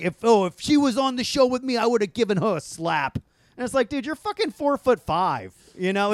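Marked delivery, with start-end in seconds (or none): 0.0-3.2 lightly voiced tough guy, raised pitch; Joe Pesci. Imitating a show host threatening a slap, lightly mock-tough. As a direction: mildly mimic an indignant, threatening actor. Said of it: "he basically said".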